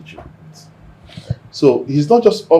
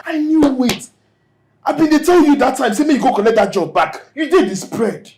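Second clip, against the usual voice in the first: high-pitched, agitated reproach; exasperated scolding; 12 semitones higher than usual. Acted. Friend scolding him for losing the cleaner job, exasperated.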